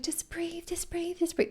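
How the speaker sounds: high, soft, sing-song just breathe; parroting everyone's calm advice; mock soothing advice. Mimicking people telling her to just breathe, high and soft. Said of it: "Everyone's like".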